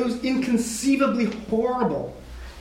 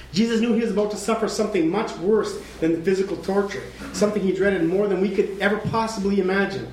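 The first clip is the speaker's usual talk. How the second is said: usual speaking voice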